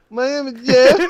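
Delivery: light Jeff meme imitation; meme quote. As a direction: say it like the dim cop. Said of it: "He's like".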